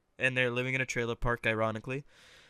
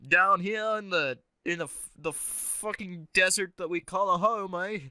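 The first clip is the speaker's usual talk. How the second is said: higher-pitched, hammed-up Australian; Australian accent; 4 semitones higher than usual. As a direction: put on a bad Aussie accent. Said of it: "try to do Australian".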